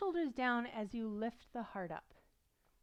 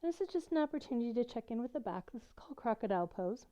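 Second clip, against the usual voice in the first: usual speaking voice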